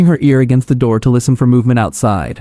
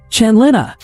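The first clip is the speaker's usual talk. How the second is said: second call pitched much higher; impatient call, much higher; calling out louder; 9 semitones higher than usual. A narrator's reading. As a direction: call her name again, higher and more urgent. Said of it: "started calling out".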